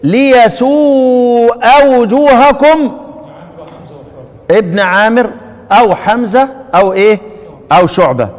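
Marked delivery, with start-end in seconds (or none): 0.0-3.0 very slow recitation of ليسوء with hamza; recited reading. Shown as a demonstration. Teacher quizzing students on a reading, slow chant. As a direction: chant ليسوء وجوهكم, stretched. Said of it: "طيب لو سمعت كده ايه؟".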